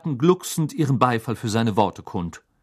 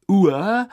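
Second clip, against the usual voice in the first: high retching Uah, louder; disgusted gag; 9 semitones higher, 6 dB louder than usual. A narrator's reading. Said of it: "ziemlich treffende Beschreibung". (angewidertes Würgen, hoch und laut)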